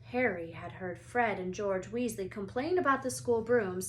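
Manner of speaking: ordinary delivery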